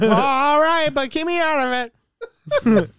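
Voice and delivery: high, loud, put-on dad voice; hammed-up dad giving in; easygoing dad. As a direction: shrug it off like a permissive dad. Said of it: "He's like".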